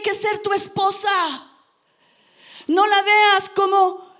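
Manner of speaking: preacher urging husbands loudly; preaching at full force. Real, not acted. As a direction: drive the point home to the church. Preacher exhorting congregation.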